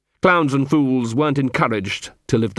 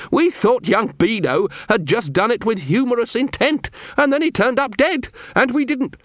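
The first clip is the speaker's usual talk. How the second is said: rising panic; 11 semitones higher than usual. A narrator's reading. Clown blurting out the death, pitch soaring in panic. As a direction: pitch climbing high, voice cracking toward panic.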